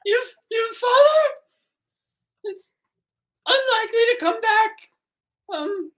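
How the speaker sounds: put-on squeaky high crying kid; a tearful little child explaining.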